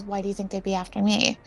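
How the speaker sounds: soft, quick, worried question; genuinely concerned. Acted. Player as character asking why she'd be a target, genuinely concerned. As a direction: ask it quietly with understated concern. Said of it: "Sounding genuinely, like, concerned".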